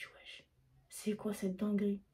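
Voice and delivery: breathless stunned whisper, barely voiced; shocked whisper. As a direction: whisper it to yourself in disbelief. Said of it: "je me suis dit".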